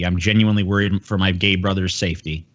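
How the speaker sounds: normal talking voice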